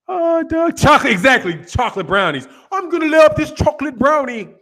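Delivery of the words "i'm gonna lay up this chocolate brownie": high, put-on British insult voice; mock British threat. Mock Brit threatening with a dessert.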